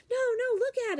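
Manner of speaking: put-on very high, loud kid insisting; excited child. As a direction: shout it like a thrilled first-grader. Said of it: "She's like".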